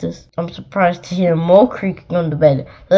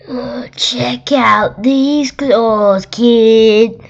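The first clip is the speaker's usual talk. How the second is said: slow, louder monster boast; third monster; 4 semitones higher, 5 dB louder, much slower than usual. Acted. Monster showing its claws to a kid, boasting slowly. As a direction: show off the claws with swagger. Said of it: "a third voice from under the bed rapped".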